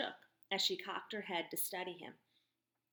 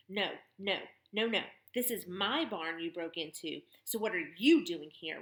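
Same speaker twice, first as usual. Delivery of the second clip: louder, mocking turnaround of his question; mocking tough woman; 5 dB louder than usual. Barn owner mocking the boy's question, loud and sardonic.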